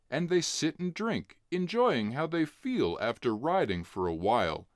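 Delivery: plain reading voice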